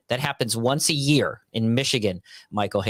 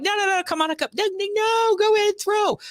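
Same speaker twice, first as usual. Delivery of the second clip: very high, squeaky mock pleading; frantic polite refusal. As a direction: wave them on in a high falsetto. Acting out waving a bystander on.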